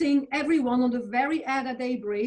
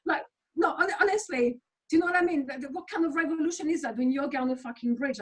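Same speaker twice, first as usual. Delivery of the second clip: incredulous; 6 semitones higher than usual. Real, not acted. Incredulous rant about yoga protest.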